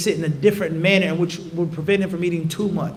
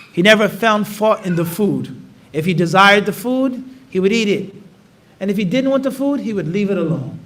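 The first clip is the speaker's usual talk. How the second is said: usual speaking voice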